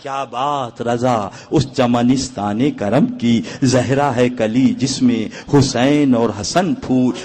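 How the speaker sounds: solemn, slow poem recital; poetic recitation. Acted. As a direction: draw the couplet out with reverence. Recites a verse couplet.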